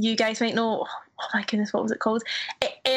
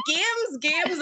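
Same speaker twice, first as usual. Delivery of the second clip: high-pitched echo of an Irish-tinged slip; mock Irish slip; 7 semitones higher than usual. Mocking own audition blunder, Irish-tinged and high. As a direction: repeat the word higher, exaggerating the Irish slip. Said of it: "went pure Irish at the end".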